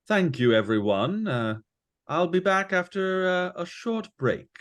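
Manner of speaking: a hint of posh performer; posh young bard. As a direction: address the tavern like a gentleman. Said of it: "strangely posh".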